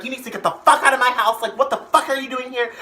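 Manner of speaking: loud, high angry yelling; going off. Retold or imitated. Mom ordering the guest out, going off. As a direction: imitate a furious mom throwing someone out. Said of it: "And just fucking going off".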